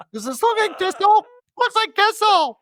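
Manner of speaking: very high squealed mock question; hammed-up, high-pitched disbelief; mock incredulous questioning. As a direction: push it way up into a comic squeal. Acted roll call escalating to absurdity.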